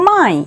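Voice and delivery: মাই said as "mai" in one syllable; teacher modelling মাই; pronunciation demo. Shown as a demonstration. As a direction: say মাই, the letters M Y blended. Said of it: "এমওয়াই উচ্চারণ হবে".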